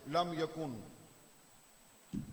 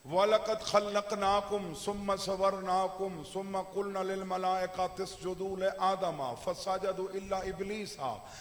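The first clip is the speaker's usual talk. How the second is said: measured recitation swelling into a drawn-out loud close; verse recitation; 6 dB louder, slower than usual. Acted. Preacher reciting a supporting verse to his gathering, building in recitation. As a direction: recite the verse, then hit the last phrase loud and slow. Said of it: "मैं इहदे नाल दी एक बई आयत पढ़दा".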